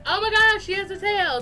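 surprised. Retold or imitated. Sudden realisation of forgotten tail.

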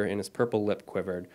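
ordinary delivery

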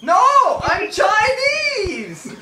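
high, slow, hammed-up crying shout; mock wail. Insisting to a friend he's not anorexic, hammed-up wail. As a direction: wail it like fake sobbing.

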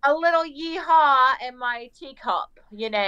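slow southern twang gone British; southern British accent. Pretend. Answering friend's southern-British remark, mixed southern-British accent. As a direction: mix a southern drawl into mock British. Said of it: "the southern is pretty similar to Br-, British".

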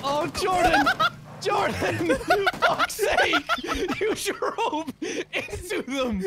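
high, exasperated cries through laughter; helpless laughing. Real, not acted. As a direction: wail it while cracking up. Laughing at teammate's blunder.

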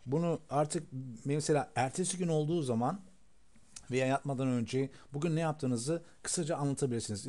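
ordinary talk

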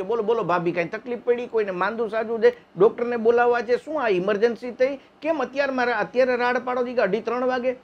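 high, worried neighbour just woken; alarmed neighbour. Retold or imitated. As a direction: rattle off questions high and worried.

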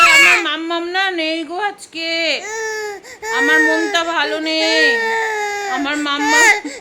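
put-on high, loud, slow whining child voice; sing-song a sulky child's plea; whiny child voice. Mother voicing her toddler's sulky plea, high slow whine.

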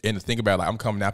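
usual speaking voice

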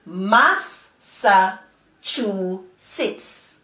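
"mas, sa, chu, sits" as four separate beats; slowly broken-up teacher's "mas, sa, chu, sits"; syllable by syllable. Shown as a demonstration. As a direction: pause after each of "mas, sa, chu, sits". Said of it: "the four syllables together are".

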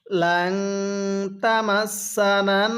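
drawn-out chanted recitation; slow melodic Quranic chant. Shown as a demonstration. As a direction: recite it like a tajweed teacher. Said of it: "আরেকবার".

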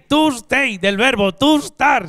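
ordinary talk

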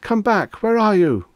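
high, loud panicked call; frantic cry. A narrator's reading. Reggie calling to the vanished agent, frantic cry. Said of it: "he cried".

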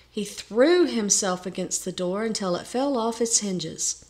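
ordinary delivery, nothing marked

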